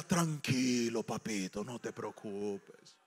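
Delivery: low, soft and slow soothing; tender comfort. Acted. Lo que uno espera que Dios diga, tierno y suave. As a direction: lull a scared child gently.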